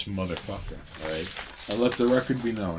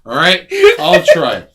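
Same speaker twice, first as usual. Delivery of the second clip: exasperated loud; 8 semitones higher, 15 dB louder than usual. Real, not acted. Exasperated after name teasing.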